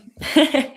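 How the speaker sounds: brief laugh before answering; quick high giggle; short laugh. Real, not acted.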